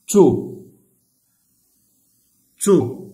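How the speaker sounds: تو called out loud, short and clear; model syllable. Shown as a demonstration. Teacher modelling the syllable for pupils, loud clear تو. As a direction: belt out تو for the class to copy. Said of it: "ماذا نقول؟".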